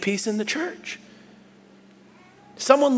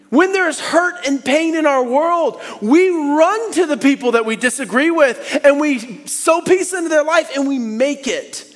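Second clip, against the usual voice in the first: loud, high sermon climax; louder, higher preacher's call; preaching at full force; 9 semitones higher, 5 dB louder than usual. Acted. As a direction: preach it out with fire.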